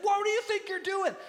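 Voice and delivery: high, loud angry demand; acted furious scolding; furious trainer. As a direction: lay into him, outraged. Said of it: "the trainer is furious afterwards".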